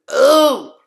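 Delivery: loud, very high thrilled oh; delighted squeal. Real, not acted. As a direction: swoon at the accent. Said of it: "sexy ass accent".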